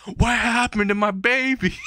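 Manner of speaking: high, drawn-out hammed-up wail; mock scream. Mom finding her son's headless body, mock scream. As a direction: play a horrified mother's shriek without real volume. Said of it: "starts to scream and says".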